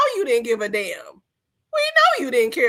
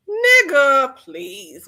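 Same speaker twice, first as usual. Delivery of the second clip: dismissive scoff; 5 dB louder, much slower than usual. Real, not acted. Dismissing the acted scene.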